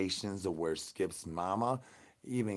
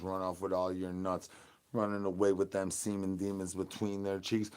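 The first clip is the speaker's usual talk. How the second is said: ordinary talk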